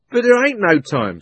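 loud, high cockney protest; urgent cabby. A narrator's reading. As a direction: cut the policeman off. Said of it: "said the cabby".

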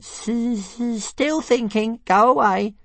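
high stuttering dragon, dismissive; high squeaky brush-off; Toothless. Acted. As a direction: shoo him off mid-thought. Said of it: "he just replied".

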